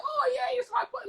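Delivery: very high-pitched mocking squeal; squeaky parody of someone thrilled; mock delight. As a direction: imitate gushing excitement in falsetto. Mimicking their happiness about gift.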